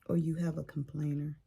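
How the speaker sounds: usual speaking voice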